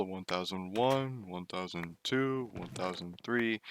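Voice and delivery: imitating mom's slow count. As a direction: drag out each second. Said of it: "she counted slow".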